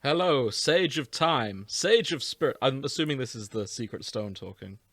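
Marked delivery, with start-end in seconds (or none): 0.0-2.6 Matt Berry voice. Acted. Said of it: "you're doing the Matt Berry for this one".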